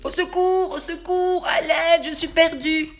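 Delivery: high, loud cry for help. Acted. Lost teddy calls for help, high loud cry. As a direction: call out lost and scared. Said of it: "il entend crier".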